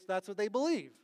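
ordinary talk

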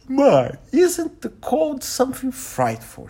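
high-pitched, slow, friendly lady's voice; drawn-out, high ladylike small talk; amicable elderly lady. A narrator's reading. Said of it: "she noted amicably to Poirot".

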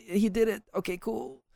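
high, strained voice of a tense parent watching; nervous parent. Retold or imitated. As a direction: squeak it out like a parent holding their breath. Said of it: "you can kinda get intense".

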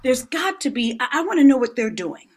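rising, quick and eager; excited. Retold or imitated. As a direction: burst out with eager curiosity. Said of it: "And I said".

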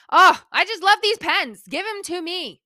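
loud gush that eases off; excited. Real, not acted. Gushing to viewers about beloved pens, excited. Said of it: "I'm all excited about pens".

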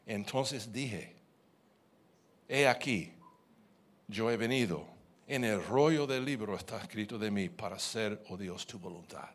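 solemn, measured reading of a psalm; scripture reading. Acted. Preacher reading scripture to the congregation, solemn.

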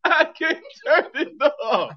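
loud, high, slow through laughter; helpless laughing protest. Real, not acted. Host stuck in the accent, cracking up laughing.

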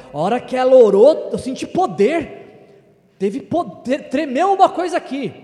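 hammed-up excited testimony; mock ecstatic admirer. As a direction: overdo the thrilled churchgoer. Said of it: "alguém falar".

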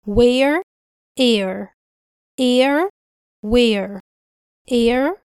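where and air with the same open vowel. Shown as a demonstration. Teacher matching where to air. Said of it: "نفس الصوت اللي في كلمة".

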